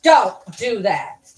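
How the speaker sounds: slow, loud command to a dog; real reprimand, loud and slow; stern scolding. Real, not acted. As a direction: tell the dog off firmly, each word drawn out. Scolding the dog right after shouting.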